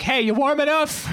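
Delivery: high, chirpy mom call; fussing mom. Acted. Mom over a snowbank to her freezing son, high fussing call. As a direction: pop up calling in a high mock-mother voice. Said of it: "my mom would just pop up".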